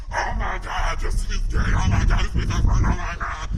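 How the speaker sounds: loud, shrill-free mock gasping gossip; loud mock outrage of a gossiping viewer. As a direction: ham up a scandalized onlooker.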